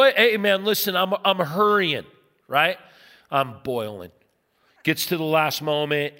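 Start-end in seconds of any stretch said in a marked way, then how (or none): 0.0-2.0 harried protest. Acted. Harried dad answering yelling driver.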